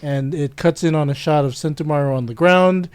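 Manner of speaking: normal talking voice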